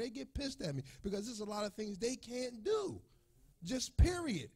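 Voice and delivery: high, strained voice near sobbing; pleading through tears; real tearful voice, high and cracking; tearful, voice breaking. Real, not acted. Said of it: "It's making me emotional".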